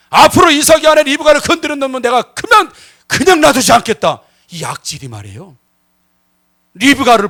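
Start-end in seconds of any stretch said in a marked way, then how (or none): 0.1-4.2 high, loud proclamation; a king laying down the law; royal decree. Acted. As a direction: announce it to the whole court.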